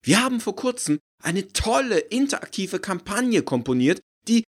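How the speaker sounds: high, loud, thrilled pitch; eager marketing woman, high and loud; enthusiastic exclaiming. Acted. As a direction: sell it with beaming enthusiasm. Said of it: "rief von Gerteljung begeistert".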